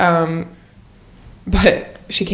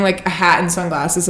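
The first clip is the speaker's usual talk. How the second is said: normal talking voice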